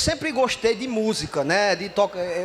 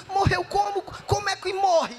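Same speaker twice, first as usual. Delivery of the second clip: high, shouted, incredulous questioning; stunned questions; 8 semitones higher than usual. Retold or imitated. Son firing questions at his mother, high disbelief. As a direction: demand how it could happen. Demanding how the dog died.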